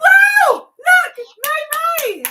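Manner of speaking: high, loud, overjoyed cries; excited shouting. Real, not acted. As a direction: shout with sheer delight. Ball moves, family cheers.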